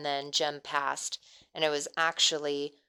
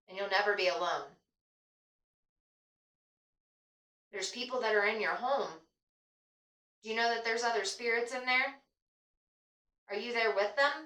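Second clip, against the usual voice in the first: higher, gentle coaxing voice; talking to a ghost; 5 semitones higher than usual. Real, not acted. Addressing the ghost in session.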